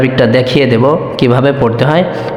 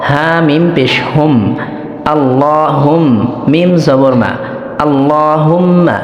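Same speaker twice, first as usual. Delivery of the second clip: Arabic pronunciation drill. Shown as a demonstration. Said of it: "উচ্চারণ ঠিক রেখে পড়ার জন্য".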